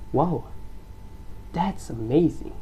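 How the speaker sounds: "Wow" high then dropping; rise-fall. Shown as a demonstration. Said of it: "You see the rise and the fall here".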